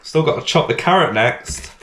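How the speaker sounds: yelling in pain with onion in his eyes; real yelling. Real, not acted. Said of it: "I shouldn't yell".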